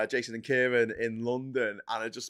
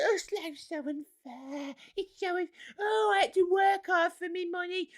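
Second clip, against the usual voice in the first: much higher, slow and softer whining; whiny gammon; 4 dB quieter than usual. Retold or imitated. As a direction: moan about hard work in a squeaky whine. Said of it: "they're sitting there going".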